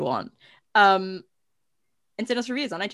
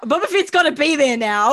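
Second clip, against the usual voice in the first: louder, emphatic insistence; loud declaration; 4 semitones higher, 8 dB louder than usual. Acted. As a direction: proclaim it with gusto. Repeats line, now loud.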